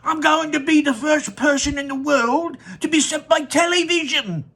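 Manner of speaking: put-on high, eager boyish boast. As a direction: crow about being first. Boy boasting while running.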